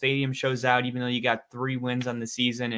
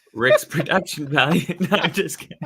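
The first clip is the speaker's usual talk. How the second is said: laughing tease; 3 semitones higher, 4 dB louder than usual. Real, not acted. Teasing co-host, laughing.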